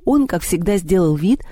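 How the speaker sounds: ordinary delivery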